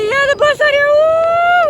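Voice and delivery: very high, loud panicked report; panicked squeal. Retold or imitated. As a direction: blurt it out shrieking in alarm. Panicked call to school.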